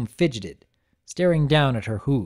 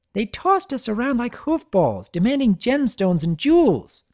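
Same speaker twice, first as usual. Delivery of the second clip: high filly voice; 9 semitones higher, 3 dB louder than usual. Acted. Voices Sweetie Belle.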